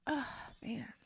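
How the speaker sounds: usual speaking voice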